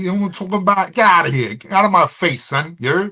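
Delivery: loud, high-pitched New York street talk; New York accent. Acted. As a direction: get in their face like a hammed-up New Yorker. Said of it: "New York is they talk like this".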